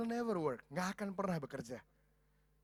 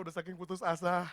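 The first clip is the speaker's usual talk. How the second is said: normal talking voice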